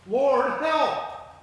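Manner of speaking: high, loud plea to God; cry for help. A narrator's reading. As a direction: cry out for rescue as if in trouble. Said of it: "they cried in their trouble".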